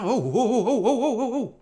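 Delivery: high mock panic cries; imitating a driver skidding on ice; hammed-up frightened oh oh; freaking out. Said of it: "Are you freaking out?".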